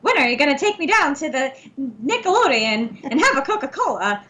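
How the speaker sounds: put-on lilting old-time movie starlet voice; Joan Crawford. Co-host repeating her Coke line on request, old-time starlet voice. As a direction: play a nineteen-thirties film actress, pitch swooping. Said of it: "That is Joan Crawford in her early days".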